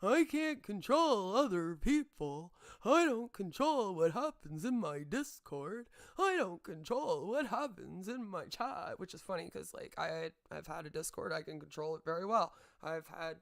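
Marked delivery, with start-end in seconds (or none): 0.0-9.0 loud, slow, mocking whine of CJ; mocking impression of a streamer dodging blame. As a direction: sneer out his excuses slowly. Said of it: "he sits back and he goes".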